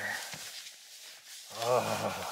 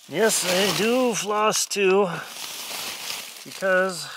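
loud, slow and insistent; drawn-out emphatic yes; 6 semitones higher, 7 dB louder, slower than usual. Real, not acted. Insists he flosses.

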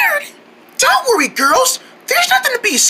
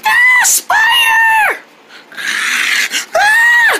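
frightened scream; slower than usual. Acted. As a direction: scream and run from the spider. Spider following them.

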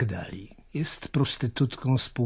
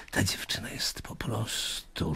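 whisper; 2 semitones lower, 7 dB quieter than usual. Acted. Dismissing Salome as a madwoman.

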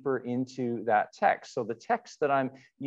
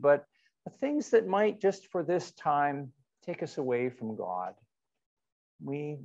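measured liturgical invitation with long pauses; call to worship; 2 semitones higher than usual. Acted. Pastor inviting the online congregation into worship, with long pauses. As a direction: ease the congregation into worship, phrase by phrase.

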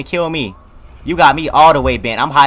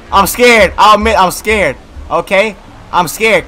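raised, insistent admission of fear; scared; 4 semitones higher, 4 dB louder, slower than usual. Real, not acted. Player admitting to viewers he is scared, rattled. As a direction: admit the fright, pitched up and loud.

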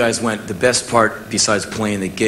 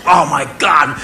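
starstruck shout; 6 semitones higher, 6 dB louder, slower than usual. Retold or imitated. Chuck D says the band was dope.

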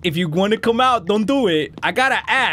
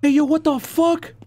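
freaked-out alarm; 10 semitones higher, slower than usual. Real, not acted. Player spots the spider mommy, high freaked-out yelling. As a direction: exclaim in rising panic.

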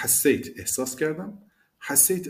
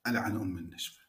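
muttering to himself; 2 semitones lower, 5 dB quieter than usual. Retold or imitated. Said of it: "با خودش میگه".

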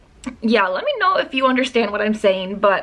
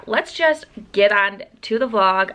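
ordinary delivery

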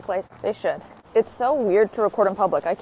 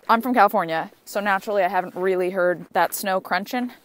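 normal talking voice

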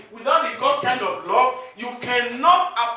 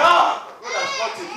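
much higher, gleeful exclamation; mock gloating; 13 semitones higher, 6 dB louder than usual. Believer gloating over enemy's sick son, mock glee. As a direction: crow over the enemy's bad luck.

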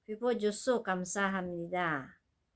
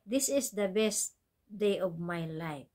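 slow model phrase; slower than usual. Shown as a demonstration.

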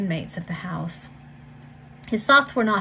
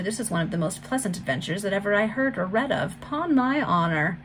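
ordinary delivery

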